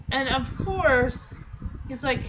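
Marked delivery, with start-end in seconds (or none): none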